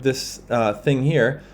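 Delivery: ordinary talk, nothing marked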